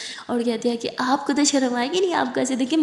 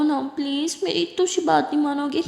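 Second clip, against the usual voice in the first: smiling plea; 4 semitones higher than usual. A narrator's reading. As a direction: coax her sweetly, stretching it out. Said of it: "ایسے بول کے مسکراتی". (مسکراتے ہوئے دھیرے سے منت)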